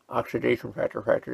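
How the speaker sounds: speaking while taking a bite; talking with food at lips; biting into chocolate bar. Real, not acted. Seen 0: Bar brought to mouth and bitten from 1.6s while speaking.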